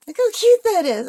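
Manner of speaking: high, loud delighted burst; excited. Real, not acted. Gushing over painting again.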